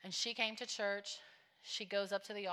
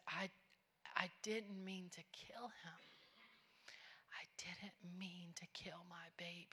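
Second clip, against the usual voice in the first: very soft, halting whisper; whispered confession; 12 dB quieter than usual. Retold or imitated. Tough woman confessing to the church girl, hushed anguished whisper. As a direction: retell an anguished confession under the breath.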